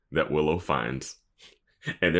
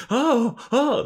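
very high squeals of alarm; hammed-up skittish panic; mock fright; 4 dB louder than usual. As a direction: squeal as if a mouse is loose. Imitating reaction to a loose mouse.

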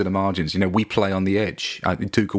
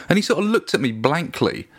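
ordinary talk, nothing marked

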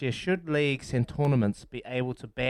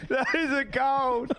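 laughing; 10 semitones higher, much slower than usual. Real, not acted. Laughing, slow.

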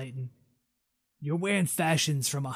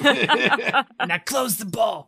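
slow, loud, higher laughing order; spider demand; 6 semitones higher, 7 dB louder, much slower than usual. Acted. Spider tells captor to shut the ball, slowly and loudly. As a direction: draw it out, demanding. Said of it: "way less resonant".

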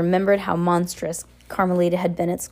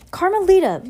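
high, louder call to Carmelita; urgent call; 12 semitones higher, 5 dB louder than usual. A narrator's reading. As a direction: call her name out high, imploring. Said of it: "Violet's voice said".

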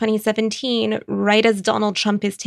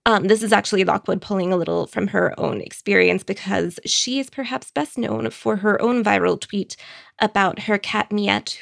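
ordinary talk

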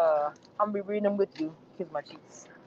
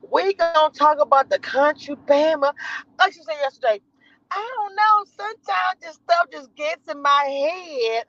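lightly put-on higher airhead voice; a hint of a ditzy reality star; Jasmine voice; 7 semitones higher than usual. Mimicking the airhead, lightly and higher. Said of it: "And my Jasmine voice".

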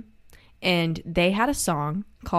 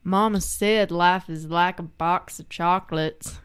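Forrest Gump quote; 3 semitones lower than usual. Retold or imitated. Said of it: "what does Forrest Gump say".

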